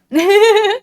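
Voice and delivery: sudden high laugh; burst of laughter. Real, not acted. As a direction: laugh out loud, short. Laughs at edgy hairstyle.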